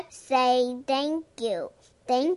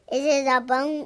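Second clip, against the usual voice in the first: ordinary delivery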